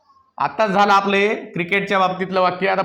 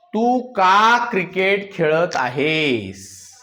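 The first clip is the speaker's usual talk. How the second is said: drawn-out words, at half speed; slow dictation; much slower than usual. Shown as a demonstration. Teacher giving the class a sentence to translate, slow dictation. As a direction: dictate it slowly, stretching each word.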